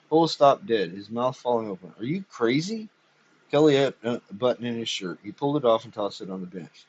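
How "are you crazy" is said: higher, disbelieving; stunned disbelief; shocked. A narrator's reading.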